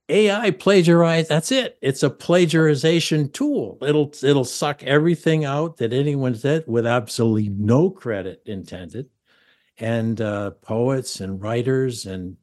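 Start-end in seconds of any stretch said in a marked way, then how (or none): 0.1-9.1 loud, high-pitched indignant realisation; excited outburst. Real, not acted. Host seizes on AI as a plagiarization tool, excited outburst. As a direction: burst out with it, raised and emphatic.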